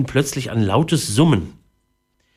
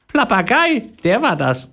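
high cheeky parrot-like answer; 12 semitones higher than usual. Acted. Boy grinning and blaming the parrot in a squeaky voice.

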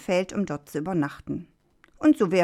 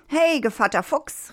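high-pitched hail; calling out; 9 semitones higher than usual. A narrator's reading. Rooster hails the fox who carries him off, calling out. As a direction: call to the fox in a lifted voice. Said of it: "rief der Hahn".

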